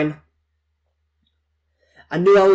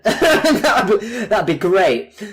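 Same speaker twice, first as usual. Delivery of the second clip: ordinary talk, nothing marked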